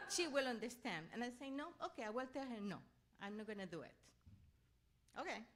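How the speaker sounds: ordinary delivery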